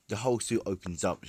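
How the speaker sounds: ordinary delivery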